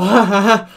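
short loud laugh; quick burst of self-amused laughter. Real, not acted. Laughs at own silly accent.

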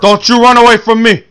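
loud, high angry call; shouting after him. Real, not acted. Player yells at the fleeing character, loud and angry. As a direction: yell after someone running off.

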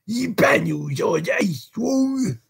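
high, slow ruffian's question; urgent demand. A narrator's reading. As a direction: call out the question, drawing it out. Sykes urgently checking the house.

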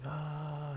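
sustained high fake child's wail; mock freak-out wail. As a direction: hold a high pretend tantrum cry. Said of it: "he's freaking out".

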